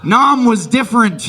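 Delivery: loud, high defensive cry; outburst as war veteran. Acted. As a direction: insist on it like a haunted veteran. Veteran grandpa outburst continues.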